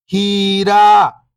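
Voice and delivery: whole word, level then falling at the end; syllables blended. Shown as a demonstration. Teacher showing beginners the joined word, syllables blended. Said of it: "इनको आपस में लाके पढ़ना है".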